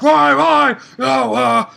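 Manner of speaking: mock outraged shout; pissed-off yell. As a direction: throw the why at her in fury. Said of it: "he's so pissed".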